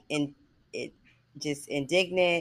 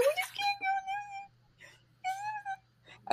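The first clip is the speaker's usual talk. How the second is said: much higher, wailing half-sob; mock crying plea; 8 dB quieter, faster than usual. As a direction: whine it through fake tears. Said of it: "high-pitched cries".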